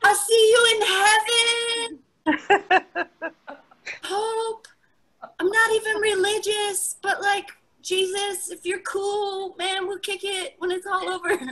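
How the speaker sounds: high, hammed-up tearful parting; milk it like the end of Titanic; melodramatic farewell. Comics saying goodbye like a Titanic finale, hammed up. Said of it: "final scene from the Titanic".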